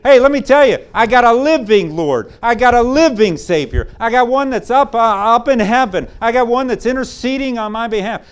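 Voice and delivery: high, loud, joyful boasting; excited believer. Retold or imitated. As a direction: proclaim it with bursting joy. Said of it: "walking around happy, excited".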